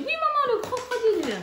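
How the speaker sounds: put-on high, childlike voice of her small daughter; mother playing her little daughter choosing a crocodile, childlike voice; little girl voice. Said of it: "Elle m'a dit".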